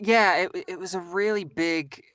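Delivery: ordinary talk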